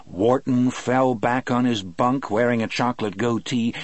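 ordinary delivery, nothing marked

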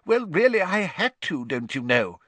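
high, irritable minister starting loud then dropping. A narrator's reading. Minister defends himself to the press, irritable. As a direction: protest testily as a harried official. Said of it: "said an irritable Fudge".

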